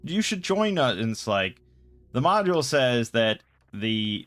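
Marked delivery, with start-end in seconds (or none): 0.0-1.0 high, sugary sweet-talk to a monster. Retold or imitated. Sister sweet-talking a trapped manticore, high and cooing. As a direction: coo at it like a lost kitten. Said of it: "sweet talking".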